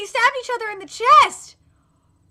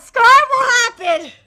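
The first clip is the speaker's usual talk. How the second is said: normal talking voice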